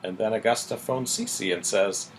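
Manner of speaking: ordinary talk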